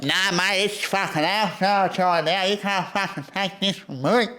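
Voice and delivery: high, put-on crackhead voice; crackhead accent. Crackhead arguing as he breaks into a boarded-up house, put-on accent. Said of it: "that was a pretty good accent".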